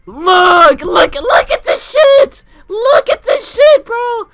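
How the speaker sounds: high, loud, slow disbelieving shout; loud outraged shout at the picture; outraged yelling. Real, not acted. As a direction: yell it like you can't believe your eyes. Showing the pizza, outraged.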